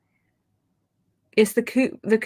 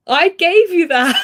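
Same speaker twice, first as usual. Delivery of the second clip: proud exclamation; 10 semitones higher, 9 dB louder, slower than usual. Retold or imitated. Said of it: "And I'm like".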